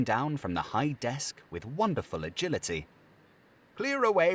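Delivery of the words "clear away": "Clear away" much higher, louder, merry; "Clear away" hailed by a jolly master; jovial order. Acted. Fezziwig's shouted order.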